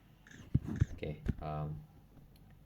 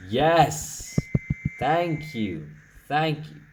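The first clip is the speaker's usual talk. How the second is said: relieved cheer; 6 semitones higher, 6 dB louder, much slower than usual. Real, not acted. Relief as strike misses.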